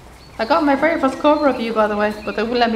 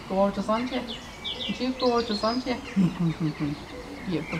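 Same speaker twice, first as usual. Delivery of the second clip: 1 cooing; 2 semitones lower, 8 dB quieter than usual. Real, not acted. Cooing to stallion.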